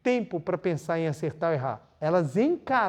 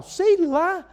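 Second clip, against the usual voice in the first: much higher, louder throwaway I-don't-know; pitched-up mock indifference; dismissive shrug; 11 semitones higher, 5 dB louder than usual. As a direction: toss it off with a shrug. Preacher's dismissive reply.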